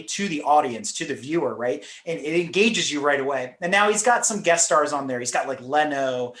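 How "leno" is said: ordinary delivery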